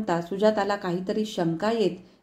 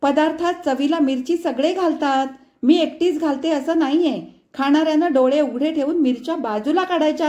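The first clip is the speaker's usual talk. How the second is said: sharp, loud scolding mother, higher; irritated raised voice; snapping; 7 semitones higher, 5 dB louder than usual. A narrator's reading. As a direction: snap at her daughter. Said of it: "डाफरली".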